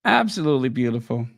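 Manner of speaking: ordinary talk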